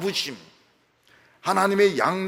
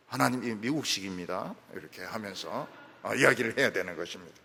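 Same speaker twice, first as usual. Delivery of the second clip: ordinary talk, nothing marked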